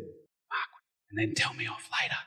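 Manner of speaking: hushed demonstration whisper; barely voiced, confidential aside. Shown as a demonstration. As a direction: whisper so listeners must lean in. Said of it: "some of yous would have to lean in".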